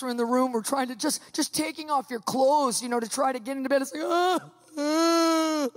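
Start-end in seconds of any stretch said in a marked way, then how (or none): 4.0-5.7 drawn-out whimpering "ah"; comic high moan of dread; cowardly lion wail. Retold or imitated. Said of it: "I felt like the cowardly lion".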